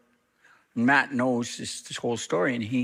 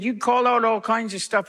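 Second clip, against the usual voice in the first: higher, heated retold accusation; animated; 10 semitones higher, 4 dB louder than usual. Matt confronting the pastor across the table, heated. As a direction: push it up and out. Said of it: "all of a sudden got animated".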